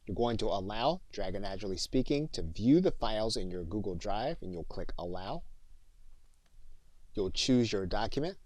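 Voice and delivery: normal talking voice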